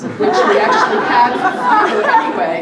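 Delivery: giggling through the words; laughing. Real, not acted. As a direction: laugh while reading it out. Amused aside while reading tribute.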